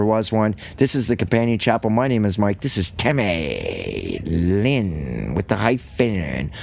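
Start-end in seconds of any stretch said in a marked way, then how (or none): 2.6-6.5 slow, lower teasing introduction; slow playful intro. Real, not acted. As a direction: savor the joke of the hyphen.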